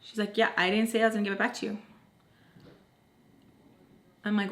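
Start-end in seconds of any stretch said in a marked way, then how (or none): 0.4-1.8 quick, offhand retold reply; quick dismissal. Phone holder refusing to hand it back, said quickly.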